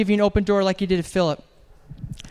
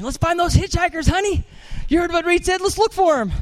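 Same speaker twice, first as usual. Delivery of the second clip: excited congregant; 8 semitones higher than usual. Retold or imitated. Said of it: "I can see it right now".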